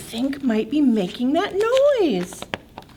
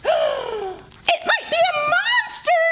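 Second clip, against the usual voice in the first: much higher scared mouse; frightened squeak. Acted. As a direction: squeak it out in fear. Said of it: "squeaked Mouse".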